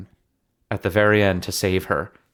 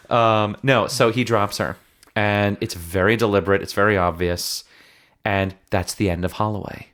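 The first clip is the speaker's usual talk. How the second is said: ordinary talk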